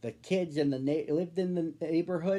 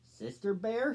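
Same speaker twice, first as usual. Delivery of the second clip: higher, mocking question; unfriendly disbelief; 7 semitones higher than usual. Queenie mocks Sister's name, higher sneering question. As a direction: scoff at the name. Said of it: "wasn't exactly friendly".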